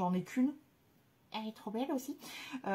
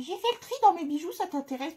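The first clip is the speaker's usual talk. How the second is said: mock subscriber message; 8 semitones higher, 4 dB louder, faster than usual. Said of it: "on m'envoie souvent des".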